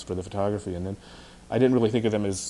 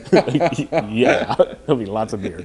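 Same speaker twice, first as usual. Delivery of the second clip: laughing; 2 semitones higher, 7 dB louder, slower than usual. Real, not acted. Laughing reply.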